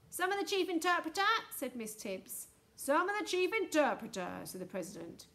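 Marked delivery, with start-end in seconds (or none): 0.1-1.2 high, loud summons; calling out. A narrator's reading. Miss Tibbs summoning the chief interpreter, high call. 2.8-4.4 echoed call. A narrator's reading. President echoing Miss Tibbs's summons, calling out.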